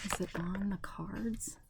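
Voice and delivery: soft unvoiced whispered question; whispering. Real, not acted. Asking quietly aside.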